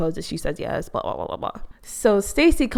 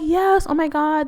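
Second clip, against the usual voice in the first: excited squeal; 8 semitones higher than usual. Retold or imitated. Woman confirming she is Lydia, excited squeal. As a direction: squeal it like you just got recognized.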